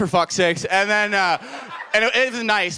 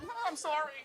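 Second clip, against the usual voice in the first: much softer meek sorry; timid apology; 8 semitones higher, 16 dB quieter, faster than usual. Retold or imitated. Comic apologizing to the angry manager, meek and much softer. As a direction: shrink back and apologize. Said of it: "I'm like".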